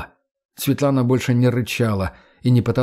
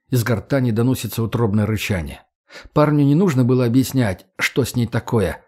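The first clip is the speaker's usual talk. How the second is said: usual speaking voice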